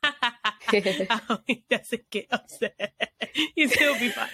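talking through real laughter; slow words broken up by giggles; laughing. Real, not acted. Guest nervous he might get upset, laughing.

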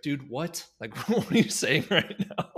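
incredulous laughing disbelief; baffled. Retold or imitated. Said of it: "And he'd be like".

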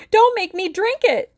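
very high, loud acted shriek; full-voiced piercing plea; rising shriek. A narrator's reading. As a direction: shriek it in frantic pleading. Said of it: "Her voice rose to a shriek".

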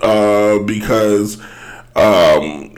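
normal talking voice

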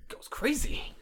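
whispered, high mumbled excuse; mock evasive guy. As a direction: sheepishly blame the girl. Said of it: "And then they're like".

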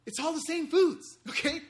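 giddy laughing punchline; laughing reveal. Real, not acted. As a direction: blurt it out through a laugh. Excited reveal of the shortcut.

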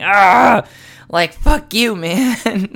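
loud, high-pitched mock fury; half-laughing re-enactment of cursing at a pet; retold angry yell. Man retelling chasing his cat with a curtain, angry. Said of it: "swung it around at him, going".

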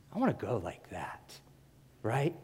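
hushed, breathless whisper; whispered awe. Real, not acted. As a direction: drop to a whisper as if awed. Quiet sermon climax on martyrdom.

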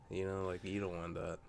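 normal talking voice

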